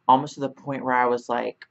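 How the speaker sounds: ordinary delivery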